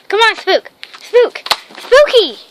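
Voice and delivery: high, loud coaxing call. Real, not acted. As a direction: call him in, pleading a little. Coaxing dog to come.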